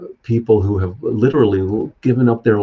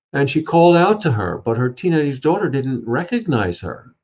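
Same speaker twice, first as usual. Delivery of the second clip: usual speaking voice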